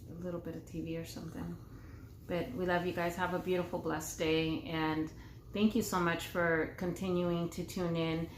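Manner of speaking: ordinary talk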